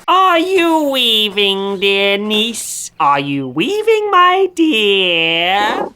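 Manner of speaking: high, slow sing-song witch voice; high drawn-out crone calling from her bed; witch asking sweetly. A narrator's reading. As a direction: coo it like a crafty old witch. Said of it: "Baba Yaga woke up and asked".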